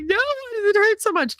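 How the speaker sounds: high, wailing complaint; whiny mock crying. At the clinic, pleading with the doctor, high whiny wail. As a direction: whimper like it really hurts.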